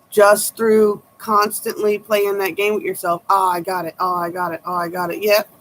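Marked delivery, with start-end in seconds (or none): none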